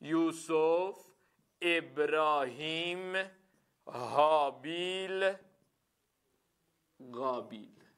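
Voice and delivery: names drawn out slowly while writing; writing names on the board, slowly; slow dictation. Shown as a demonstration. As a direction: say each name slowly as you write it.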